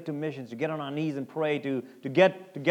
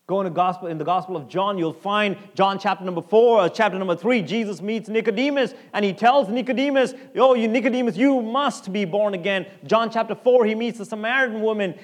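loud, higher, driving sermon voice; preaching at full force; 8 semitones higher, 9 dB louder than usual. Acted. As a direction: drive the point home to the church. Preacher building again.

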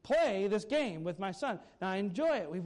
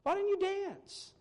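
put-on high, loud child calling out to passersby; be the indignant little kid shouting at adults; pitched-up child's shout at the businessmen who ignored the song; kid yelling; 14 semitones higher, 4 dB louder than usual. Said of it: "The kid yells out".

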